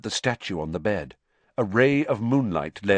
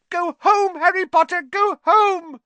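very high, loud squeal; Dobby squealing; 8 dB louder than usual. A narrator's reading. Elf refuses to tell Harry who did it, squealing. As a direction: squeal it like a panicked little elf. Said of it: "squealed the elf".

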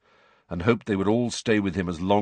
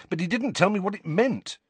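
higher, frustrated complaint; impatient; 9 semitones higher than usual. A narrator's reading. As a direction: let the grievance spill out impatiently. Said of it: "said Harry impatiently".

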